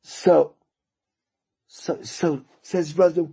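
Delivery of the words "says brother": normal talking voice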